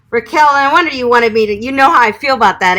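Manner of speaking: playfully riled up at a viewer; teasing mock outrage at the question; worked-up outburst. Real, not acted. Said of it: "trying to get me hot under the collar".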